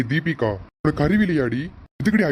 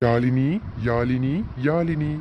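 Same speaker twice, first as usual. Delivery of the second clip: slow tender naming; much slower than usual. Acted. Father names baby slowly.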